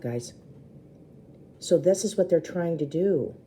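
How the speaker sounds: ordinary delivery, nothing marked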